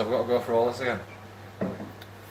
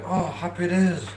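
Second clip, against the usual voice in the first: high, louder, slow sarcastic "Oh, happy days"; mock delight; 7 semitones higher, 5 dB louder than usual. Retelling finally getting monetized, high mock delight. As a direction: savor it with mock joy. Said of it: "I was like".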